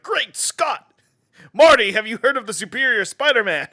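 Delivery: high, loud, excited scientist impression; Doc Brown. Imagining a movie mascot asking about comics, loud excited impression. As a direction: exclaim it like a frantic movie inventor. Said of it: "Doc Brown from Back to the Future was like".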